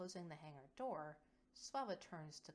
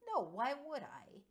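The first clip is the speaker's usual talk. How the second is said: amused; 8 semitones higher, 11 dB louder than usual. Acted. Laughing reply.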